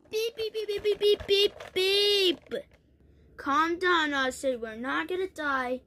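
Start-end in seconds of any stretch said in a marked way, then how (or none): 0.1-2.6 high, quick beeping; imitated alarm beeps. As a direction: beep like an alert going off.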